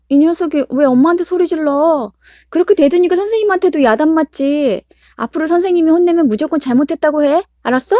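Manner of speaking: high, loud furious mother; angry scolding. Acted. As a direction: tear into the kid. Mother scolding a defiant child.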